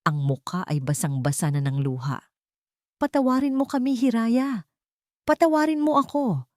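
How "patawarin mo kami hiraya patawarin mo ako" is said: high-pitched, grief-filled begging; pleading cry full of sorrow; anguished plea. A narrator's reading. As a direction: beg forgiveness in anguish, voice raised high. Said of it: "Sigaw nito, ang boses ay puno ng paghihinagpis".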